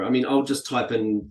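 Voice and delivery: ordinary talk, nothing marked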